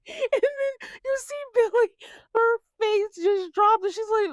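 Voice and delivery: high, squeaky talking through laughter; real giggles lifting the voice; laughing. Real, not acted. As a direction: tell it while cracking up. Laughing while telling the funny moment.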